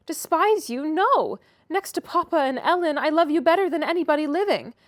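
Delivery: put-on high and loud, an eager young girl; eager protest. A narrator's reading. Catherine reassuring her sickly cousin, high and eager. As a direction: rush to reassure him.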